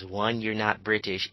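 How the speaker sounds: usual speaking voice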